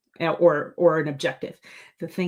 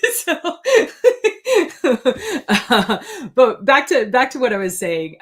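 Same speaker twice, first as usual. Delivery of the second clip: real giggles, higher and louder, then words still laughing; giggling; 8 semitones higher, 6 dB louder, slower than usual. Real, not acted. Author to viewers, giggling at her own rambling. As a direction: laugh it off and get back on track.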